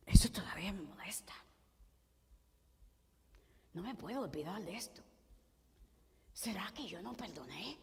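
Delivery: hushed, half-whispered self-questioning; quick, soft, wavering thought aloud; troubled inner doubt. Acted. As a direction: murmur it to yourself as a creeping doubt. Voices inner doubt after forgiving.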